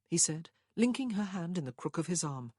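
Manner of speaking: usual speaking voice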